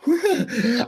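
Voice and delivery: high, brief real laugh; short laugh. Real, not acted. Preacher unwilling to admit he hates, laughing it off high. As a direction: let out a quick high-pitched laugh.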